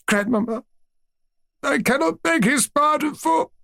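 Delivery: put-on very high tearful child's plea; choked, high-pitched boyish protest; choking on tears. A narrator's reading. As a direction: plead through rising tears, breaking off mid-sentence. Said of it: "tears that were choking me".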